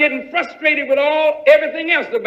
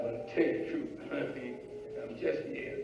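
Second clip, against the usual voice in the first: much softer, half-whispered admission; embarrassed trailing off; 10 semitones lower, 19 dB quieter, much faster than usual. Acted. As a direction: let it fade away sheepishly.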